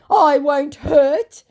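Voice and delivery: high, loud, drawn-out plea; mock hurt. Scammer pleading for friendship, mock hurt. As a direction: overplay a wounded plea, slowly. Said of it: "sending about six insane hurt emojis".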